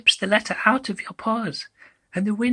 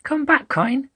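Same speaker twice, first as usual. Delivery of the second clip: usual speaking voice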